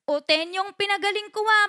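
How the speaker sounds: ordinary delivery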